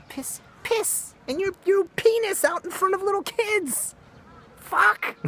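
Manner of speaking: very high, strained disbelief; squeal of disgust. Real, not acted. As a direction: voice cracking up in outrage.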